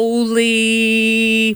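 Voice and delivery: long drawn-out, stunned exclamation; retold shock. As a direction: stretch it out in disbelief. Retelling her shocked discovery.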